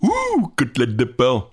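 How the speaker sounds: a squealed cartoon-style line; switching impression voices. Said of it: "qui fait la meilleure imitation?".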